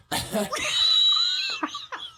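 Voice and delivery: high, screeching burst of laughter; loud shrieking laugh. Shown as a demonstration. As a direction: let a wild laugh rip. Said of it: "I'm like".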